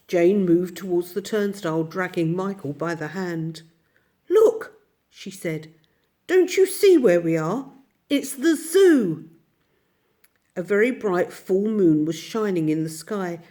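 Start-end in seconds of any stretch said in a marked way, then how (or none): 4.3-9.3 higher, louder, slow on zoo; wide-eyed reveal of the zoo; excited discovery. Acted. Jane showing Michael they are at the zoo, excited.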